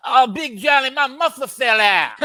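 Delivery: high, loud, laughing wrestler voice; Dusty Rhodes impression. Dusty on the mat telling his opponent, laughing and loud. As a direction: ham up the old Texan, laughing. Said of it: "the impression of Dusty Rhodes".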